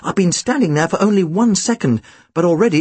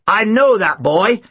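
slow, higher-pitched call; drawn-out announcement, pitched up; 5 semitones higher, slower than usual. A narrator's reading. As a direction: call it out slowly to the room. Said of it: "he called out".

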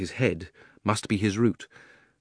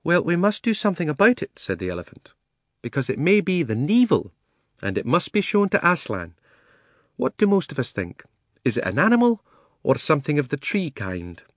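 genteel elephant voice; 6 semitones higher than usual. Acted. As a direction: speak like a proud matron. Elephant speaks.

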